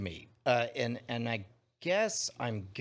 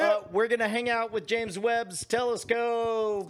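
loud sign-off; 9 semitones higher, 6 dB louder than usual. Real, not acted. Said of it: "show sign-off".

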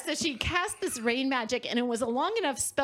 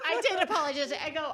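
ordinary talk

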